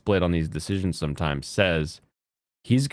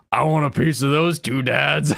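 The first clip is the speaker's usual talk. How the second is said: hungry, higher-pitched mock outburst; mock ravenous moms; 5 semitones higher, 3 dB louder than usual. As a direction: imitate thirsty moms pouncing on gossip. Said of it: "turn into ravenous animals".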